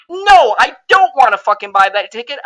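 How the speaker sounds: loud, angry refusal; fed-up fan yelling no at the studio; angry shout. Real, not acted.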